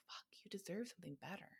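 soft, intimate self-talk; quiet inner voice. Pretend. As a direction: murmur it like a voice inside your head. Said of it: "that little voice inside of ourselves that is saying".

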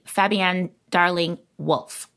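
ordinary delivery

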